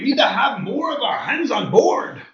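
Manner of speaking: high, loud, slow Scottish pirate voice; hammed-up Scottish pirate accent. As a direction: growl it like a Scottish pirate captain. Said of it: "What are you, a Scottish pirate?".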